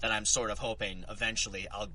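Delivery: usual speaking voice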